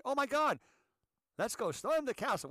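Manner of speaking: high, loud mock eagerness; mock excitement. Host mimics someone waking up to storm a castle, mock-excited. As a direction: burst out as if struck by a brilliant idea. Acts out absurd morning idea.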